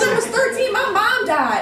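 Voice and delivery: high-pitched, worked-up outburst; hammed-up tirade, pitch way up; retold going off. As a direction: blurt it out high in re-enacted outrage. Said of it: "I went off on him".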